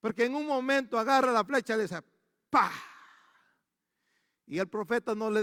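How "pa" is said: mimicked strike. Imitating arrow striking ground.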